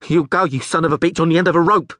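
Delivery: high, loud, shaky threat; trembling yell. Acted. Scared guard threatens the Rivian, trembling yell. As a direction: yell through fear. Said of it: "yelled the trembling guard".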